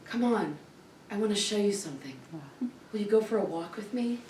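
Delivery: soft, low coaxing voice; alluring boyfriend imitation. Boyfriend inviting her on a walk, imitated softly alluring. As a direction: imitate a suitor gently luring someone along. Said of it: "handsome and cute".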